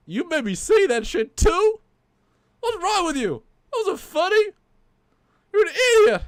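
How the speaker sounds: loud, high indignant scolding; puppet yelling. Acted. Puppet shouts at the co-host for a joke. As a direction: chew him out at full volume.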